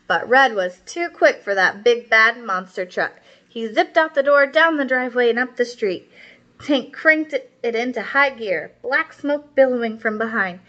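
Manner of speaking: put-on lively read-aloud for young kids; storybook read-aloud. A narrator's reading. Teacher reading a story to her class, lively storytelling. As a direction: read it out like a children's picture book. Reads picture book to class.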